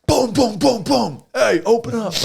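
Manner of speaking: high, loud pounding call; mock yell at the door. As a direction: bang and bark it out like a counselor.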